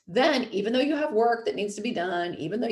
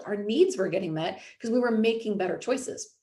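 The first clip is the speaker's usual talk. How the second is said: ordinary talk, nothing marked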